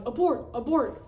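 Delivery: high, loud alarm call; re-enacted urgent cry; urgent warning. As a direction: call off the mission. Re-enacts calling off the theft.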